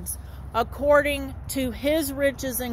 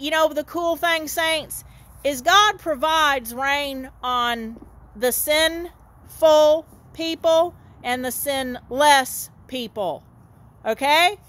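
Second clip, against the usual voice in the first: ordinary talk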